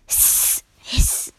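attempted cat hiss; hiss sound. Shown as a demonstration. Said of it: "I can't really make a hiss sound".

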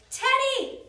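high, loud call for the dog; crying out. A narrator's reading. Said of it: "cried Annie".

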